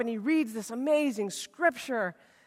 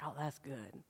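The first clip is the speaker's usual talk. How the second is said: hushed approval; 12 semitones lower, 19 dB quieter than usual. Retold or imitated. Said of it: "And they're like".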